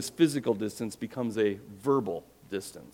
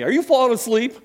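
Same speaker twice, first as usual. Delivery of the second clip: a much higher, louder mock wife voice; wife's nudge; 12 semitones higher, 8 dB louder than usual. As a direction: tease him like the wife catching him asleep. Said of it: "She'll elbow me".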